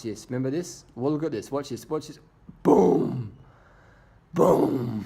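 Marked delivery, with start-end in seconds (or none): 2.6-5.0 loud, punched-out "boom"; loud impact-style "boom"; booming exclamation. Real, not acted. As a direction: boom it out at the big move. Calls the gutter jump as it happens.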